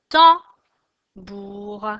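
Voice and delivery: "tambour" in two spaced beats, tam, bour; syllable by syllable. Shown as a demonstration. Teacher names the drawing for pupils, syllable by syllable. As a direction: hit tam loudly, pause, then bour in "tambour". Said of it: "Deux syllabes".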